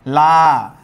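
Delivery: লা loud and held on its আ; stretched vowel. Shown as a demonstration. Teacher isolating the second syllable for students, লা loud and stretched. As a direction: call out লা with a long, clear আ. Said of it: "লয়ের সাথে আ উচ্চারিত হচ্ছে".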